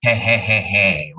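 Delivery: slow, low "hehehehe"; put-on chuckle. Shown as a demonstration. Preacher showing the laugh added after a written rebuke, slow fake chuckle. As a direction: spell out a deliberate low laugh. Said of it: "jadi dia tahu dia ketawa".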